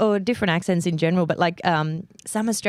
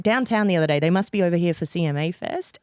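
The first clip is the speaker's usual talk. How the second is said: normal talking voice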